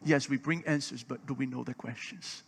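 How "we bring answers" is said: ordinary talk, nothing marked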